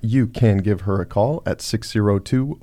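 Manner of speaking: ordinary delivery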